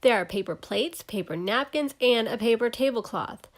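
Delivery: usual speaking voice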